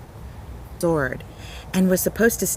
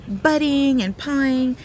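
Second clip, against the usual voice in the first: ordinary delivery, nothing marked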